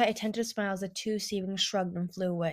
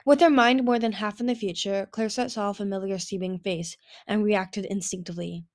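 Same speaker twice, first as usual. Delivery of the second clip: ordinary delivery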